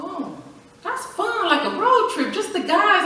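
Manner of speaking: high, bright put-on cheer; mock cheerful. To husband about his Louisiana trip, high mock-cheerful. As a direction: pretend it's just a fun road trip. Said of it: "I said".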